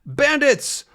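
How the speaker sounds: high furious yells of the words; yelling after the gangsters' car. Acted. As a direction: hurl the insults after the car. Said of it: "he yells".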